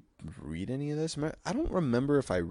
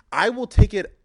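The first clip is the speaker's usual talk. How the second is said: sarcastic; 6 semitones higher, 8 dB louder than usual. Acted.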